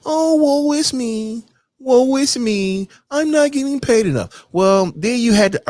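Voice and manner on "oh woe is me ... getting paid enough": higher, slow, hammed-up woe-is-me whine; mock the complainer, dragging it out; mock whining coworker. Mocking the coworker who moans about pay, whiny and slow. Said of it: "and moan and whine".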